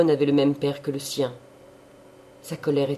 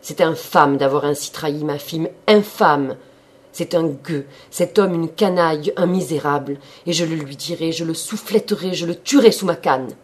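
much louder furious rant; exasperated fury; 2 semitones higher, 7 dB louder than usual. A narrator's reading. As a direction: rage and threaten. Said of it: "s'animant toujours exaspéré".

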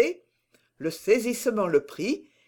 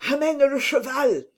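plain reading voice

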